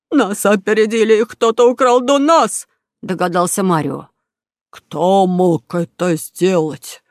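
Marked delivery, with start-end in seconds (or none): 0.1-2.7 loud, rising crook voice; dismayed robber exclaiming; indignant outcry. Acted. Robber discovers paintings were stolen. 4.7-7.0 very slow, drawn-out crook grumble; indignant robber voice; angry question. Acted. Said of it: "сердито спросил у друга Бруно".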